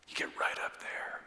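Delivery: close, intimate whisper. Shown as a demonstration. Pastor showing how close whisperers get, hushed whisper. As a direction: whisper it right up at the cheek. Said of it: "usually when they whisper".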